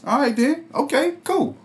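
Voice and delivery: high, soft sulky resignation; sulky Cain. Retold or imitated. Retelling how Cain took God's rejection, high, soft and sulky. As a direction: let it go with hurt pride, softly. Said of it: "So that's how Cain took it".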